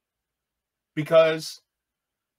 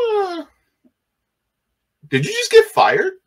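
incredulous friends; 6 dB louder than usual. Retold or imitated. Friends ask if he's fired.

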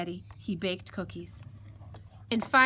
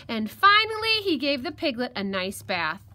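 ordinary delivery, nothing marked

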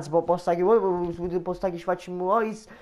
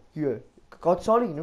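ordinary talk, nothing marked

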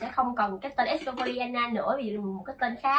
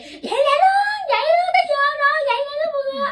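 very high, loud squeaky voice; squeaky high impression; 8 dB louder than usual. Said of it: "À, kiểu vậy".

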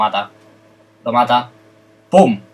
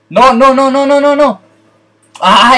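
panicked; 12 semitones higher, 8 dB louder, slower than usual. Real, not acted. Misclicked, panicking in game.

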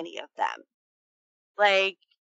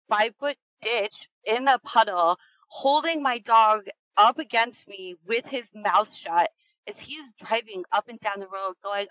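ordinary talk

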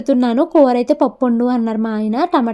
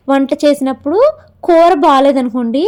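normal talking voice

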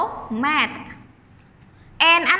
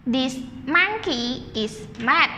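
slow, spaced read for the class; slow choral read; slower than usual. Shown as a demonstration. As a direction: read it slowly for everyone to join. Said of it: "Repeat after teacher".

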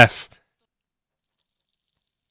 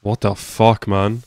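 normal talking voice

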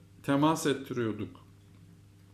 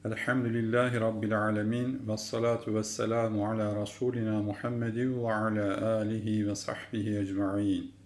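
opening prayer; 4 semitones lower than usual. Acted. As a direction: recite the opening blessing gently and formally. Opening prayer before lesson.

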